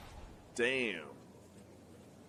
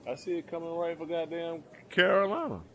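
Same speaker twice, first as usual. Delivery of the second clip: loud exclamation; 8 semitones higher, 5 dB louder than usual. Real, not acted. Hurricane heading for Carolina.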